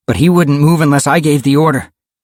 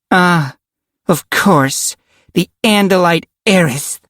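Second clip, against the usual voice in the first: higher-pitched, drawn-out sneer; 8 semitones higher than usual. A narrator's reading. Karen greets the Andalite, sneering. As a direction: sneer at the newcomer. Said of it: "looked at him and sneered".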